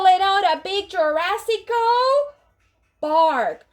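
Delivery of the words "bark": ordinary delivery, nothing marked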